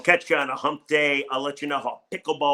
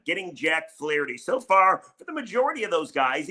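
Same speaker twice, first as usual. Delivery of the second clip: normal talking voice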